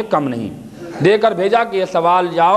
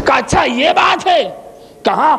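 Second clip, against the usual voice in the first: preaching at full force; 7 semitones higher than usual. Acted. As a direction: ring out each answer to the crowd. Ali's answer preached loud.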